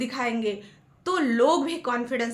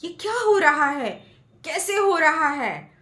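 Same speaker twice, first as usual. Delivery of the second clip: amazed; 5 semitones higher, 5 dB louder than usual. A narrator's reading. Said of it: "बच्चा बिल्कुल surprise हो गया".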